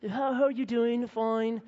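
high, posh-mimicking English accent at a tea party; playful fake English accent over tea; mock English accent. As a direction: put on a silly English accent. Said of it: "we spoke in funny accents, you know, like English people".

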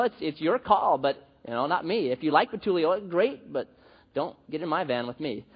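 ordinary delivery

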